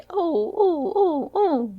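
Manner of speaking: high muffled English-style "oh, oh" moans; braying English "oh"s, pitched well up; English intonation. A narrator's reading. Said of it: "entonação inglesa".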